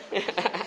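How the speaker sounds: short, soft low chuckle; quiet amused laugh. Real, not acted. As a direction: chuckle under the breath. Laughing after teasing a shy child.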